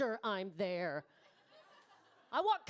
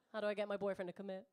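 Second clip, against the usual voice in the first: usual speaking voice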